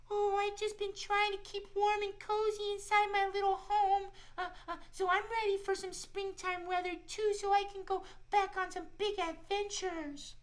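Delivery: put-on a friendly shy squirrel talking to kids; squirrel puppet voice. Squirrel puppet Shiloh tells the kids about winter, high cheerful voice.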